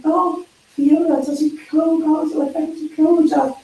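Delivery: lightly hammed-up fake flattery for Fiona; mock gushing praise. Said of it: "we should like".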